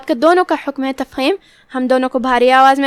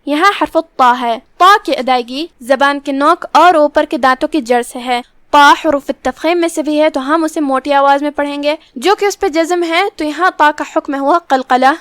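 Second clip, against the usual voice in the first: ordinary delivery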